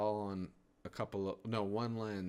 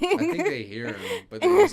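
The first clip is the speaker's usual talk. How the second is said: loud joking; 16 dB louder than usual. Real, not acted.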